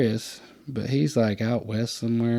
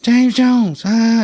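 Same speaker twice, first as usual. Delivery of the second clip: much higher, much louder joyful call of a name; excited greeting; 11 semitones higher, 9 dB louder, much slower than usual. Retold or imitated. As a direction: shout the name like a long-lost buddy. Friend greets him by name.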